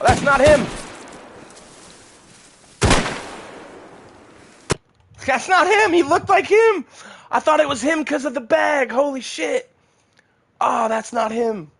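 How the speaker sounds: panicked high shouting; frantic yelling while being chased. Real, not acted. Being shot by enemy.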